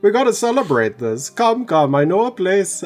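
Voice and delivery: a hint of a German accent slipping in, eager and merry; weird German-ish accent. Player character inviting his sworn friend out, mild German-ish accent. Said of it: "I'm going, like, a weird accent right now".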